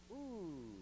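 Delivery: ordinary delivery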